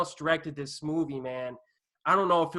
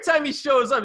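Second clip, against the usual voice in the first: ordinary talk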